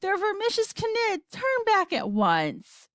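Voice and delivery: high alarm, slowing on the order; mild scream, pitch up at the start; screaming grandma. A narrator's reading. Grandma warning of the Knids, screamed in alarm. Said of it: "screamed Jo-, Grandma Josephine".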